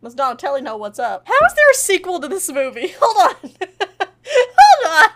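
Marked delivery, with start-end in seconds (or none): 1.3-5.2 high squealing laughter breaking up the words; real laughing fit, voice shooting up; helpless laughing. Real, not acted. Cracks up over the movie having a sequel, helpless laughter.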